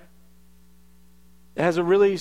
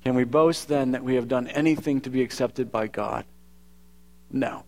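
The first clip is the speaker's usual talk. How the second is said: scripture reading; 5 semitones lower than usual. Acted. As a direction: read the passage gently and reverently. Reads scripture before preaching.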